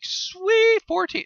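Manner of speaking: high squeaky delight; mock excited. Gamer cheering the clock after scoring, high squeal. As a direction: chirp it, thrilled. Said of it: "I was like".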